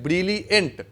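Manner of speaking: ब्रिलियंट split as ब्रिली, a slight pause, then यंट; syllable break shown. Shown as a demonstration. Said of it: "ब्रिली के एक बार बोल करके एंड के लिए आप हल्का रुक रहे हो".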